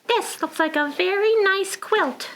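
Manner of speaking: high little penguin voice. Acted. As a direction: compliment as the penguin. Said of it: "said Penguin".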